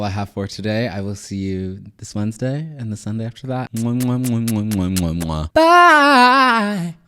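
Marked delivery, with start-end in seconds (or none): 3.7-5.5 rapid kiss sounds; blown kisses. Real, not acted. Blowing kisses goodbye. 5.5-6.9 very high, loud sing-song bye; squealed bye. Real, not acted. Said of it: "that was messy".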